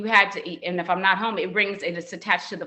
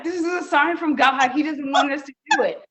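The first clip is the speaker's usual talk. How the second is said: dramatic sign; 7 semitones higher, 4 dB louder than usual. Retold or imitated. Chapel shut down, reaction.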